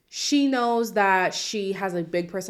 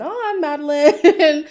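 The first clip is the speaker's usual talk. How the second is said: exasperated; 6 semitones higher, 8 dB louder, slower than usual. Real, not acted. Exasperated at Madeline's behaviour.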